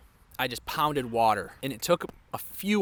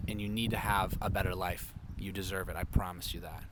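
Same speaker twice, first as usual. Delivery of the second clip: softer and lower, earnest; quiet heartfelt plea to someone struggling; sincere reassurance; 8 semitones lower, 7 dB quieter than usual. Real, not acted. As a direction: gently promise the viewer they deserve better. Sincere advice to viewers.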